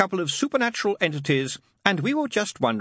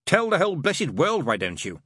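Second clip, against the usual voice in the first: high, louder, exasperated sarcasm; snapping; 8 semitones higher, 4 dB louder than usual. A narrator's reading. Crowley embarrassed by Aziraphale's praise, snapping. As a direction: snap it out, dropping at the end. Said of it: "Crowley snapped".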